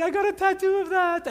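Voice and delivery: high-pitched mock protest; whiny offended congregant. Preacher mimics a congregant with the tattoo, high mock whine. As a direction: put on a high, whiny complaint.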